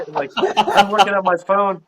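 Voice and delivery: ordinary talk, nothing marked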